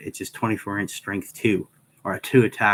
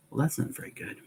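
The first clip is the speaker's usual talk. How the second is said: higher, softer breathy aside; wry aside; 6 semitones higher, 8 dB quieter, faster than usual. Real, not acted. Player judging a weak weapon: soft wry aside. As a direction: mutter it dryly to yourself.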